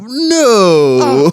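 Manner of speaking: high, loud, drawn-out fake wail; mock horror. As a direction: wail it in hammed-up disbelief. Mock horror at crusher story.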